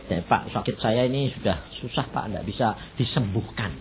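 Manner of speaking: quiet, low, weary plea; soft tired complaint of a sick man; sick man's lament. Retold or imitated. Voicing a sick person. (keluhan lirih orang sakit)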